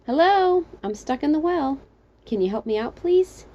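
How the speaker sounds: high call for help, lifting on please; calling back. Acted. Trapped narrator to the stranger above, calling back. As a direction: call up out of a well. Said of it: "So I called back".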